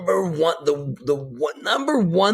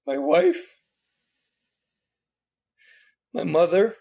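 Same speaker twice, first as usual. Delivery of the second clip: high, emotional voice, halting between names; choked up; 9 semitones higher than usual. Real, not acted. Listing the women in his life, choked up. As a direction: fight back tears on each name.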